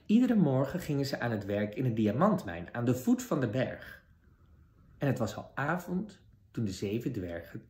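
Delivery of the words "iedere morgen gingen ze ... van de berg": usual speaking voice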